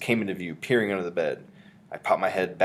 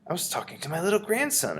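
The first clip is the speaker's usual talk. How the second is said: grandmother voice; 10 semitones higher than usual. A narrator's reading.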